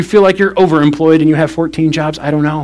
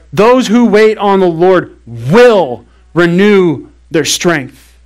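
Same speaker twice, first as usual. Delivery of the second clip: slow, every word weighed; slow emphatic verse; much slower than usual. Shown as a demonstration. Pastor pressing the verse on the congregation, slow and weighty. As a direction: lean on "Those" and draw the verse out. Repeats promise slowly.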